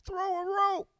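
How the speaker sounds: tiny high far-off voice; faint echo. Acted. Trapped voice asks for help, faint distant echo. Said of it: "First pussy echo".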